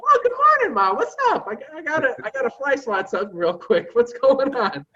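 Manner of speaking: very high, bright innocent act; overly cheerful, feigning innocence; playing dumb. Son playing dumb to his mother, high and cheerful. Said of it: "I'm playing dumb".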